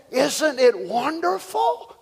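high, drawn-out wonder; wondering joy. Retold or imitated. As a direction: stretch it out in mild, retold delight. Wife rejoices at his arrival.

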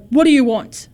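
loud, irritated captain's demand; grumpy, annoyed. Acted. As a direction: snap it at unwelcome strangers. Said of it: "grumpy and annoyed".

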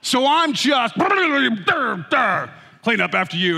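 high, loud, very slow scolding; mock martyr scolding; indignant dad. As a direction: drag out the guilt trip. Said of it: "Righteous indignation".

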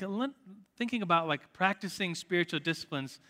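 normal talking voice

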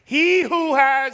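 drawn out slowly, each word weighed; slow call and response with the congregation; slow scripture quote. Retold or imitated. Preacher to congregation, slow call and response. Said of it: "he says it repeatedly".

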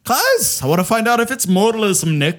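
high, lilting leprechaun voice; a poor, hammy Irish accent. Acted. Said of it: "Why are you talking like a leprechaun?".

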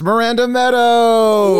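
hyped guest announcement. Real, not acted.

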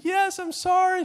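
very high whiny apology; whiny child. Retold or imitated. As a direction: whimper it like a scolded kid. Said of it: "I said".

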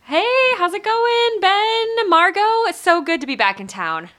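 high, loud friendly hello; Holly hailing the guild table, lifted and loud; Holly's warm greeting. Acted. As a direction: greet old friends on coming home. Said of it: "Holly walks up ... and says".

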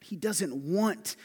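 "want" drawn out slow and high; stress on want. Shown as a demonstration. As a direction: stretch "want" slowly for weight. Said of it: "slow emphatic stress".

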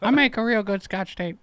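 high Southern character voice; Darlene. Acted. Darlene bragging during the bit, high Southern character. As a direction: boast in a high, put-on twang. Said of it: "I'm Darlene".

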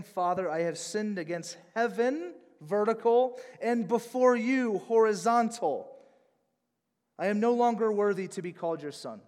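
lightly voiced repentant son; a touch of a son begging forgiveness; pleading son. Son's confession in parable.

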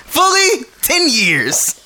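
very high-pitched, loud proclamation; mock outraged declaration. Host answering a dating question, hammed-up high exclamation. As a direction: squeal out your terms, hammed up.

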